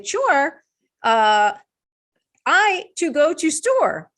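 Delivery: high, slow pet-talk voice with broken grammar; baby talk to the pet dog; choppy toddler talk to the dog. Retold or imitated. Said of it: "and I say it like that".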